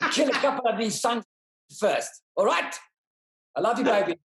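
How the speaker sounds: hammed-up South African accent, high and brash, shouting; South African mercenary accent. Actor auditioning for the interviewer as a mercenary, hammed-up South African accent. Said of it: "Matthew Goode, the South African mercenary".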